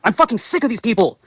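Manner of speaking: real anger boiling over; angry yelling. Real, not acted. Vlogger demanding they stop adopting dogs, angry. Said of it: "I get really fucking angry".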